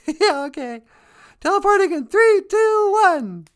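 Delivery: very high-pitched falsetto character voice; squeaky, put-on helper, puzzling things out; flustered teleporter helper. Sweetie tries to teleport the host away, flustered falsetto.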